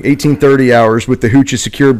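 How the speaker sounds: ordinary delivery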